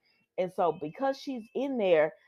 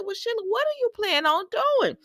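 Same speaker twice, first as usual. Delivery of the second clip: high, teasing question; sassy question; 13 semitones higher than usual. Retold or imitated. Host talking back to Sheila, high and sassy. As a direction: needle her with a sing-song ask. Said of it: "I said".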